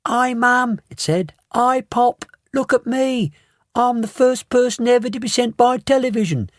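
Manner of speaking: put-on high, small squeaky boy voice; a tiny mouse-sized voice. A narrator's reading. Shrunken boy greets his parents, tiny voice. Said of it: "A tiny little voice, no louder than".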